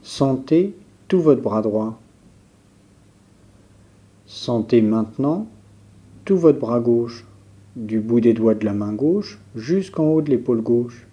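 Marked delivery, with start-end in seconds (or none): none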